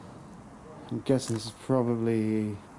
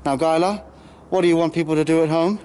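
louder and higher, mock host introducing a guest; hyped YouTuber intro; 6 semitones higher, 6 dB louder than usual. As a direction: play the overexcited channel host presenting his co-star.

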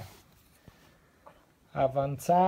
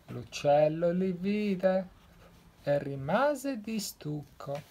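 usual speaking voice

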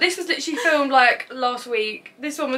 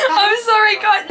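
laughing; 8 semitones higher, 9 dB louder, slower than usual. Real, not acted. Laughing apology after prank.

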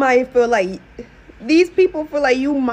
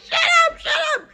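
mock yelled shut up; 14 semitones higher than usual. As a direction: shriek it at someone who won't stop talking. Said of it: "They just like".

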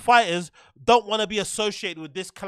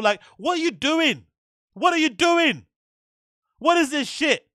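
raised, high-pitched disbelief; exasperated rant; 5 semitones higher than usual. Real, not acted. Streamer ranting about a clumsy fight move, exasperated.

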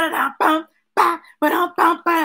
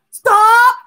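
louder stomp; 5 semitones higher, 7 dB louder than usual. A narrator's reading. Chanting sound words in picture book.

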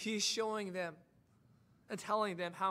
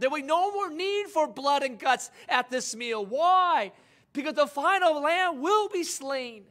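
raised, louder, higher preacher's cry; preaching at full force; 7 semitones higher, 7 dB louder than usual. Acted. Preacher to congregation about the final lamb, full-force preaching. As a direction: drive the point home to the congregation.

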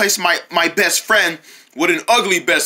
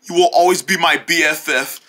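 ordinary talk

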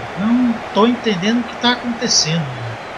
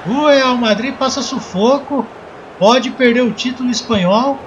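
play-by-play commentary; slower than usual. Acted. Live football play-by-play.

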